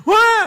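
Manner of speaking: loud, high mock bawl; imitated older child's wail. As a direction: burst out bawling like a bigger kid. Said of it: "yang sudah agak gede".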